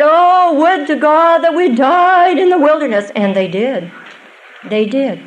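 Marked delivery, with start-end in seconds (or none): 0.0-3.1 whining Israelites. Acted. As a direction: moan it like grumbling wanderers. Israelites' whining lament voiced.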